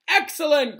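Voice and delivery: high, loud cheer; cried out praise. A narrator's reading. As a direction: cry it out over applause. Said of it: "cried Professor Lupin".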